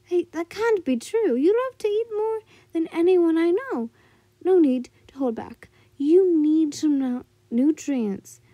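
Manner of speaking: high, loud coaxing granny; old woman voice. Acted. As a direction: push her to eat like a grandma. Old woman urges her to eat.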